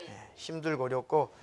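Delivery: normal talking voice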